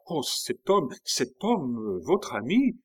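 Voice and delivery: cri rapporté, plus aigu; s'écrier d'une voix qui monte; exclamation citée. Acted. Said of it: "en vous écriant". (en s'exclamant)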